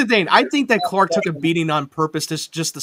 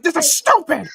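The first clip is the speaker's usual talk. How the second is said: exasperated shout; 12 semitones higher, 6 dB louder than usual. Retold or imitated. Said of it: "You're like".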